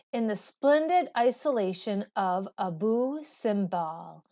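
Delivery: ordinary talk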